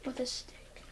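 whispered echo. Real, not acted. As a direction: whisper it back. Echoes friend's words.

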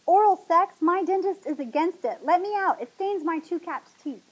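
high, loud indignant outcry; yelled protest. A narrator's reading. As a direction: shout in panicked indignation to be let out. Still yelling in protest.

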